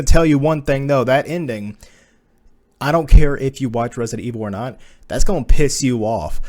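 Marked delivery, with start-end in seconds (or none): none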